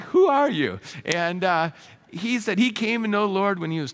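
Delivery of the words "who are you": high and loud, marvelling; amazed question. Retold or imitated. Pastor retelling his amazement at the driver, higher and louder. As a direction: ask it in happy disbelief, higher and louder. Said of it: "And I'm like".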